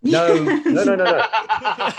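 loud, high no's, the last ones slow with laughter; loud mock outrage, laughing; laughing protest. Real, not acted. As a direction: cry out the denial, breaking into a laugh. Protesting the off-screen death claim.

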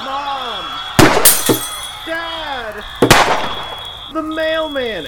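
high, distant calls; calling out. Acted. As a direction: call for help across the house. Calls out for family.

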